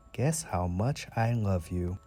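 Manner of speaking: ordinary delivery, nothing marked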